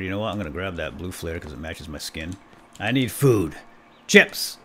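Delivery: ordinary delivery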